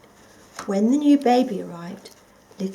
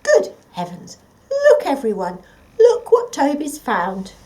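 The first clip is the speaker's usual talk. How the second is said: much higher, louder astonished cry; amazed call; 5 semitones higher, 9 dB louder than usual. Acted. Grandpa recognises the toy and calls the family, astonished. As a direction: call the whole family over in delight.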